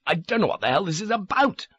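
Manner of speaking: a high-pitched desperate protest; desperate shout. A narrator's reading. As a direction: protest in panic. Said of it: "I shouted".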